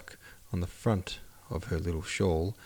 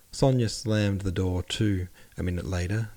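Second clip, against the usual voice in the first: ordinary delivery, nothing marked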